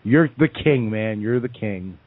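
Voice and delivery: usual speaking voice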